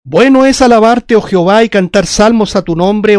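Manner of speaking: loud, declamatory psalm reading; scripture reading. Acted. Preacher reads a psalm to the brethren, loud solemn proclamation. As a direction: proclaim the verses in measured phrases, opening strongly.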